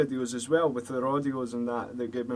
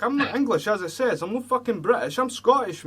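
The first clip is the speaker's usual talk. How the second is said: heated insistence; 7 semitones higher, 3 dB louder than usual. Real, not acted. Repeats identity claim, worked up.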